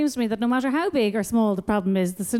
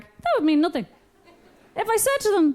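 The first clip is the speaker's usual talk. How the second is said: ordinary talk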